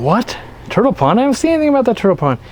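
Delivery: high-pitched, rapid mock disbelief; imitated incredulous questioning, high and quick; puzzled viewer. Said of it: "you're probably going".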